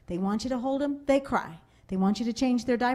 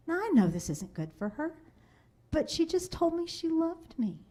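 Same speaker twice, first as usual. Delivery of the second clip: usual speaking voice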